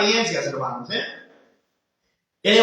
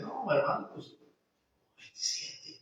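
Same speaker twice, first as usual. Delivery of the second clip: hushed reply; 2 semitones lower, 18 dB quieter than usual. Retold or imitated.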